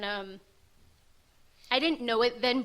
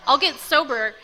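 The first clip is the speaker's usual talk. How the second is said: "I'll get sober" higher and louder, GET hit hard; angry vow; 4 semitones higher, 8 dB louder than usual. Retold or imitated. Spiting her family, angry loud "I'll get sober". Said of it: "but super angry".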